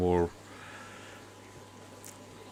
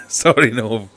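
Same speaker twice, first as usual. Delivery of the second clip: laughing sorry; 2 semitones higher, 9 dB louder, slower than usual. Real, not acted. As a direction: laugh right through the word Sorry. Apologising twice, second with laugh.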